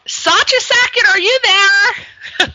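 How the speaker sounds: high, drawn-out singsong call; slow, lilting high call, stretching the last words. Real, not acted. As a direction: call the guest onto the air. Calling the waiting guest on air.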